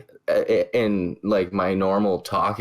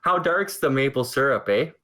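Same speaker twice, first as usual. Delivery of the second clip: higher, hammed-up Canadian lilt with a rising eh; playful Canadian accent asking about syrup; mock Canadian accent; 7 semitones higher than usual. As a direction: ask about maple syrup like a caricature Canadian. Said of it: "Part of me died in that dialogue".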